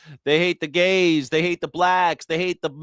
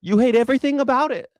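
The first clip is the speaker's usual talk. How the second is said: heated rant; 9 semitones higher, 3 dB louder, faster than usual. Real, not acted. Angry rant at MAGA.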